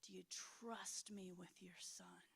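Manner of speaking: whispered, slow and grave; hushed weighty question. Retold or imitated. God pressing her in prayer, whispered and slow. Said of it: "more of a tone on it".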